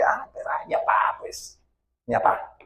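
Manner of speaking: soft whispered mock excuse; whispered, shrugging ay, mi papá. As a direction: mutter the excuse under your breath. Mimics excuse about father.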